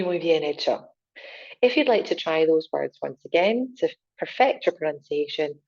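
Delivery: ordinary delivery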